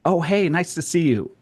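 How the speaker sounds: pleasant, noncommittal greeting in passing; friendly passing greeting. Retold or imitated. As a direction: act out a quick hello before moving on. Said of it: "take it out and be like".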